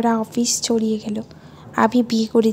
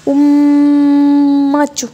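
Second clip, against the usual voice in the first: long smacking kiss sound, louder; loud drawn-out kiss; 3 semitones higher, 10 dB louder, much slower than usual. Acted. Tithi greets her husband on the phone with a loud slow kiss.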